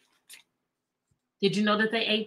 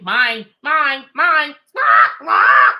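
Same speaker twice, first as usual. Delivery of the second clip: squawking seagull cry; 2 semitones higher, 11 dB louder, much slower than usual. Retold or imitated. Teacher imitating Finding Nemo seagulls for the class, loud squawk. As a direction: shriek it like a greedy seagull. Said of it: "You all know I had to do it".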